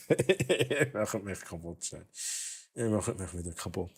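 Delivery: soft breathless laughter; giggling through the words; laughing helplessly. Real, not acted. Laughing, overwhelmed by gifts.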